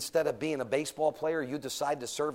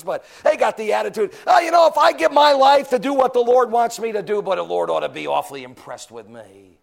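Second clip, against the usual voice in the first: loud, high preaching; preaching at full force; 7 semitones higher, 14 dB louder than usual. Acted. Preacher scolds proud teenagers, loud and high. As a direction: drive it home loudly. Preaching builds to mock quote.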